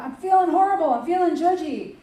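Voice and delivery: high, loud self-pitying outburst; hammed-up complaint about feeling awful; mock moaning. Preacher acts out someone feeling horrible and judgy, mock moaning. Acting out a negative inner voice.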